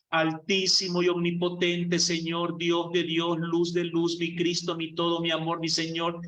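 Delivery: high, level monotone litany of titles; mock pompous prayer. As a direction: parody someone praying in grand words. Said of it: "hay que usar palabras todas raras".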